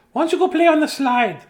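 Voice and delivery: high, loud mom voice; put-on mom. Mom sending child to the slide, put-on mom voice.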